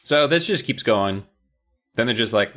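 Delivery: ordinary talk, nothing marked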